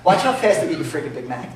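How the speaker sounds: ordinary talk, nothing marked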